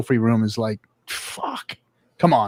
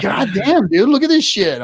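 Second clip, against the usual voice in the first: exasperated son; 8 semitones higher, 3 dB louder than usual. Retold or imitated. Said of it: "He's like".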